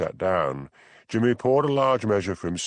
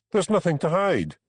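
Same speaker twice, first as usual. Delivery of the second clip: sharp, higher retort; snapped; 9 semitones higher than usual. A narrator's reading. Harry cutting off Hamish's questions, a snapped denial. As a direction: bite the words off. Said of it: "he snapped".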